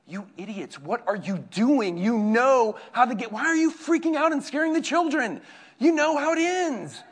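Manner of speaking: high, loud mock scolding; scolding wife. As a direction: play the exasperated wife scolding fans. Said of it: "my mom and my wife come into the room".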